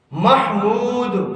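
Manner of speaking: محمود very slowly, "MAH-mood"; correct pronunciation. Shown as a demonstration. Teacher introducing the right name, correct pronunciation. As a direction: clear h and long "ooo" in محمود. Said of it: "لوگ غلط پڑھتے ہیں".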